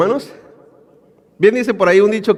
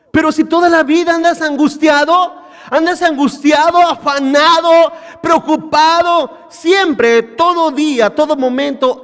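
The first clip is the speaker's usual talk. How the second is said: higher, rising preacher's cry; preaching shout; 6 semitones higher than usual. Real, not acted. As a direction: press the point hard, calling out. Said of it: "preacher raising voice".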